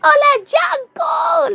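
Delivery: very high, drawn-out fan holler; shouting from afar. Pretend. Fan yelling to [name] in Chile, playful high shout. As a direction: call out playfully to an idol far away. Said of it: "le voy a gritar yo".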